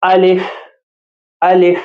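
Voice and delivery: Arabic alif as in a Qur'an reading lesson; model pronunciation. Shown as a demonstration. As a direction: clear Arabic alif, said out for practice. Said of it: "تو آئیے اب ان کی مشق کرتے ہیں".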